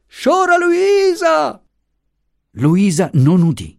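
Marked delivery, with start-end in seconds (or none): 0.1-1.6 high, drawn-out distressed call; long wailing call, pitched very high; anguished cry. A narrator's reading. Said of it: "si gridò da vicino con accento di strazio".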